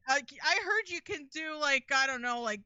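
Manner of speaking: casually rope the janitor into voice work; offhand studio boss. Acted. Acting out a casual dubbing hire.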